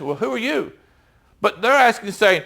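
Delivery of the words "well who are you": softer, offhand musing "Well, who are you?"; casual puzzled question. Shown as a demonstration. Reader today wondering aloud, softer and offhand. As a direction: toss it off like a modern reader shrugging. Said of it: "we can understand the question says".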